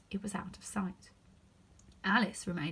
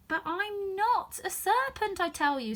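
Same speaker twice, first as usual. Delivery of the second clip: protesting; 11 semitones higher, 3 dB louder, slower than usual. A narrator's reading. Alice protesting.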